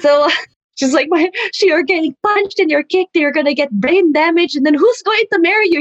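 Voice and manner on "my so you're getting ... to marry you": high-pitched, hammed-up motherly alarm; dramatic mom. Mom warns her daughter about getting punched, melodramatic. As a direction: imitate mom fretting theatrically. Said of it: "she's just so dramatic".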